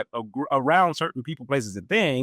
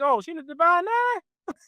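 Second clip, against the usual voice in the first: friends' mock question. Friends confusing Greek with a sorority, high and puzzled. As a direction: ask like you've misunderstood completely. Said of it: "they're like".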